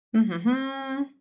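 slow, high wordless hoot; a drawn-out, playful "uh-huh"; teasing hum. Real, not acted. Teacher leaving pupils to puzzle over a riddle, teasing hum.

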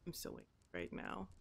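mock angry mutter. Player grumbling at the broken boat, soft quick mutter. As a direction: mutter it under your breath in mock anger.

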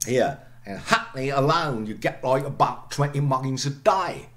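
Cockney accent. Shown as a demonstration. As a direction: say it like a Londoner, dropping the t's. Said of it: "I'm not a Cockney, I try".

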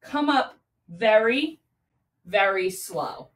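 slow-motion instruction, pausing between words; slow, drawn-out cue. Shown as a demonstration. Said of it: "come back down slow".

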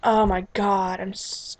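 usual speaking voice

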